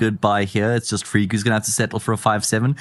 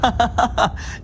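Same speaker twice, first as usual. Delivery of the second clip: high laugh; 13 semitones higher than usual. Real, not acted. Laughs at the joke.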